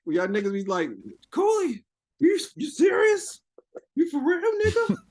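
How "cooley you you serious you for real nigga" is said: high-pitched, drawn-out disbelieving questions; exaggerated squeal of disbelief; mock incredulity. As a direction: imitate gullible followers who can't believe it. Mimics followers doubting his trolling.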